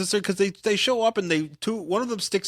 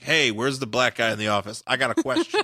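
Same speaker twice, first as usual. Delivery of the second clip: mock Jack Kirby; 7 semitones lower than usual. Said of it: "he totally was like".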